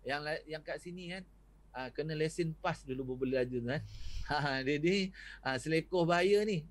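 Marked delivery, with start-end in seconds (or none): none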